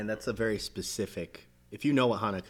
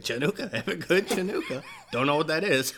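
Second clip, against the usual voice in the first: higher-pitched imitation, laugh creeping in; lightly mock a celebrity's cheery greeting; amused retelling in a raised voice; mock clueless well-wisher; 7 semitones higher, 3 dB louder than usual. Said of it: "and he was like".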